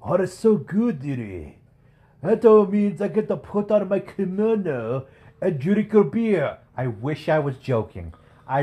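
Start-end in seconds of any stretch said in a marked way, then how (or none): 0.0-6.6 put-on high, slow Japanese-accented old man; hammed-up Japanese accent, delighted. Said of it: "stereotypical Japanese accent".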